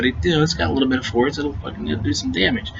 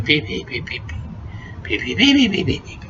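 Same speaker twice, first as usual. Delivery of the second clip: imitated chick peeps; 6 semitones higher, 3 dB quieter than usual.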